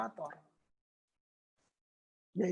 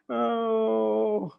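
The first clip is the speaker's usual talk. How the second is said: ordinary delivery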